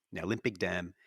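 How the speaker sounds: ordinary delivery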